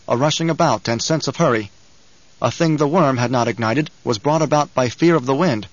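ordinary delivery

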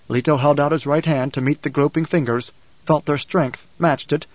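plain reading voice

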